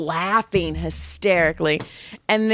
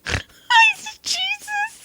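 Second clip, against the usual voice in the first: laughing squeal; much slower than usual. Retold or imitated. Laughing retell of reaction.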